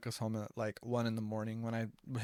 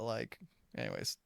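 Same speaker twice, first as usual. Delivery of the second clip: ordinary talk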